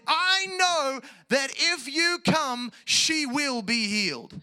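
high, slow, loud proclamation throughout; ringing shout of acted confidence; confident declaration. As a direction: proclaim it with full conviction to the end. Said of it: "He came with confidence and said".

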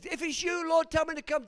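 loud, high plea over distance; excited calling out. Acted. Peter asks Jesus to summon him, excited shout. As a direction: shout it eagerly to someone far off. Peter's call acted once more.

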